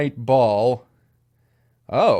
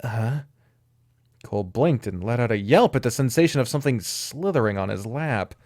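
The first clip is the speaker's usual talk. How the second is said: ordinary delivery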